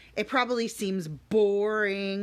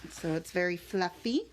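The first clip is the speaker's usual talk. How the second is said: plain reading voice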